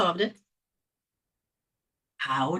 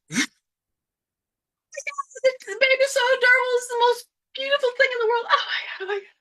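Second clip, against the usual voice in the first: put-on high, fast gushing baby talk; gushing over baby; 13 semitones higher, faster than usual. Adult cooing at newborn baby, high and gushing.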